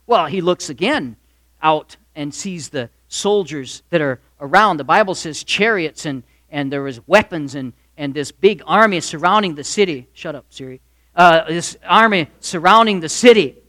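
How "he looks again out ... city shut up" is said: ordinary delivery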